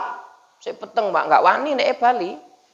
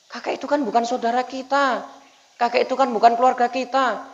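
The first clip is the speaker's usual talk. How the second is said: higher-pitched, bewildered objection; puzzled father; 5 semitones higher, 3 dB quieter than usual. Acted. Father questioning his son's tears for a stranger, puzzled. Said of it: "bapaknya ini heran, kaget".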